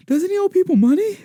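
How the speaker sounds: high, strained voice with heavy panting between phrases; mock out of breath. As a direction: imitate winded people struggling with a stuck door. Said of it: "They're so out of breath. They're winded".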